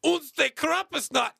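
loud, slow, mock German announcer; bad German accent. Host doing a bad German accent, slow and loud. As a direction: boom it out in a hammy German accent. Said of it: "our attempt at German accents".

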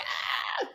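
breathless unvoiced gasp of emotion; a tearful little gasp; choked-up gasp. Real, not acted. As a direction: catch your breath as tears well up. Choked up by the memory.

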